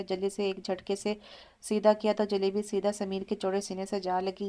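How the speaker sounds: plain reading voice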